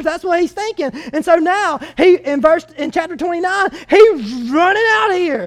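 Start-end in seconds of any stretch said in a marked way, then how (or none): none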